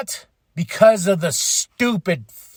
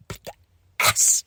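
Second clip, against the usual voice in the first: ordinary talk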